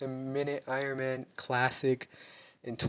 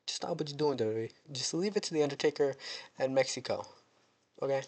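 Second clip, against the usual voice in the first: ordinary talk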